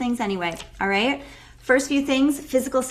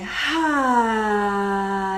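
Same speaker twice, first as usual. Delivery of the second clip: dramatic sigh. Shown as a demonstration. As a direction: breathe out in a long sigh. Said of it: "A nice just sigh".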